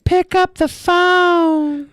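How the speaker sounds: high, very slow breathy-ad voice pastiche; drawn-out, high come-on like an old hotline commercial; sultry phone sex ad. Retold or imitated.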